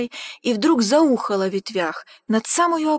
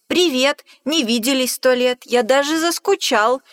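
cheery shout; 3 semitones higher, 5 dB louder than usual. Acted. Mouse calling out loudly to the snake like an old friend. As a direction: hail an old pal.

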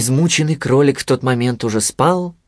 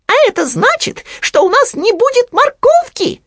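very high squeaky piglet, alarmed; Хрюня; 5 dB louder than usual. A narrator's reading. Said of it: "добавил Хрюня". (высокий тревожный писк поросёнка)

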